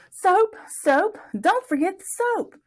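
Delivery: high, loud happy shout; proud shout. A narrator's reading. Plug shouting to his mama as he runs home. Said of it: "shouting it to his mama".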